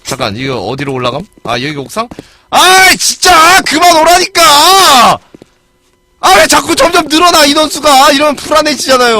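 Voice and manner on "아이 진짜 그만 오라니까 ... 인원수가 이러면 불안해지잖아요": much higher, louder, dragged out in exasperation; frustrated yell. Real, not acted. Gamer yelling at other players to stop following, exasperated. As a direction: shout at the growing crowd to stop coming. Enemies keep joining the fight.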